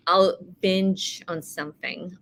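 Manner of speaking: ordinary delivery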